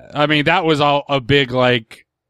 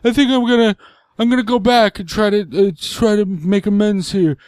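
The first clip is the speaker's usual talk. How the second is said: dad voice; 8 semitones higher, 3 dB louder than usual. Retold or imitated.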